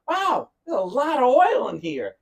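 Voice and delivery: high, loud, drawn-out wow; acted amazement. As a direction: imitate a repairman amazed by what he finds.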